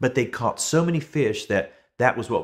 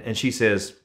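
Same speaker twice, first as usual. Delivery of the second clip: usual speaking voice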